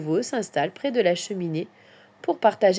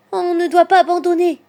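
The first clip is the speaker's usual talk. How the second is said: high, loud rallying cry; 10 semitones higher, 9 dB louder than usual. A narrator's reading. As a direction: call out to keep the friends going. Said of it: "s'écrie Petit Hérisson". (cri de ralliement aigu et fort)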